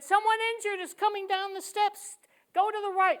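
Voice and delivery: high, loud call passed along; calling out a warning. Retold or imitated. People warning others on the stairs, calling out. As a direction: call it out urgently over a crowd.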